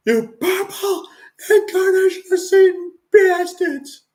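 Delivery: high-pitched New York fan rant; mock wailing tirade in a New York accent. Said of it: "with the New York accent".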